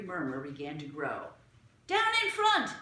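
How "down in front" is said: high, loud heckling yell. A narrator's reading. A spectator yelling at the intruders, loud. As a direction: heckle from the stands. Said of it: "yelled somebody".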